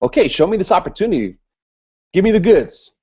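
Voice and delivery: mock eager go-getter; fired up. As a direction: act the hyped prospect pushing for the pitch. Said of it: "they have this kind of energy about them".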